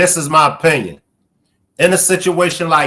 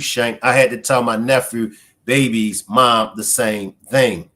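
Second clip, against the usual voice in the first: usual speaking voice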